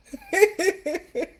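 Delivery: high delighted laugh; happy laugh. Real, not acted. A friend joins the live, delighted laugh.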